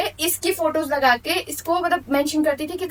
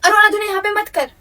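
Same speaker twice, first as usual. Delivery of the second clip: sharp, higher, louder telling-off; scolding; 6 semitones higher, 5 dB louder than usual. Acted. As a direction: snap at her to stop whining. Scolding friend at video start. (ऊँची, तेज़ आवाज़ में डाँटना)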